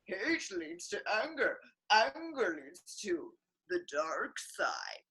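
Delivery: slow, quiet imitation of the old master; mock Yoda. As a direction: mimic a wise little master, lightly. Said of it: "that Yoda guy".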